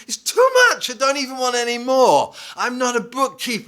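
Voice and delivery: high-pitched imitation of a woman protesting; hammed-up high-voiced complaint; mock overwhelmed protest. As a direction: act out her exasperation, voice leaping up high.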